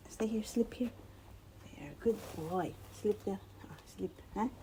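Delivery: soft whispered settling. Real, not acted. Owner settling her dog in the cone, whispered.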